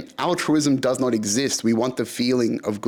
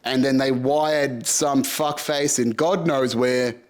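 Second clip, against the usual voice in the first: usual speaking voice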